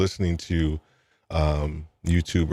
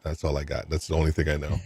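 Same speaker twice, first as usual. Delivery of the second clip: ordinary delivery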